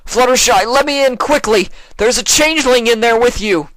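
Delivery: very loud, high shout through door; calling out. Acted. Shining Armor outside the door, very loud urgent shout. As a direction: bang and call urgently.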